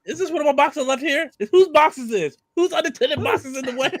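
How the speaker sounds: high, loud puzzled guard voice; mock guard. Friend acting the guard who finds Solid Snake's box, high and puzzled. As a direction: play a confused guard finding a box. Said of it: "he's portraying Solid Snake".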